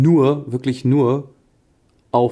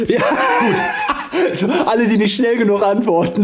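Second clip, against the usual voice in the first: laughing; 8 semitones higher, slower than usual. Real, not acted. Laughing along at the joke.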